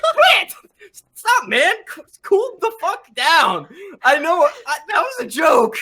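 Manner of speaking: high, loud in-character protest; exasperated shouting. Acted. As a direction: shout them down to stop the crying. Harper tells crying character to stop.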